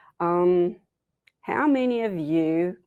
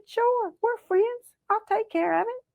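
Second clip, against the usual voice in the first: very high, sweet-talking voice; falsely friendly Rabbit; 13 semitones higher than usual. Acted. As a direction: reassure in a high, innocent trickster voice. Rabbit feigns friendship to trick Possum.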